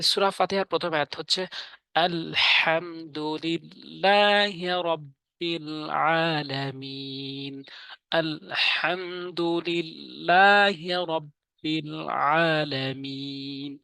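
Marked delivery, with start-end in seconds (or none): 1.9-7.6 slow, drawn-out chanted recitation; Quran recitation. Shown as a demonstration. Teacher recites the verse for viewers, slow and chanted. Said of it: "তিন আলিফ করে টেনে টেনে পড়বো". 8.1-13.8 slow, drawn-out chanted recitation; Quran recitation. Shown as a demonstration. Teacher recites the verse for viewers, slow and chanted. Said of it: "তিন আলিফ করে টেনে টেনে পড়বো".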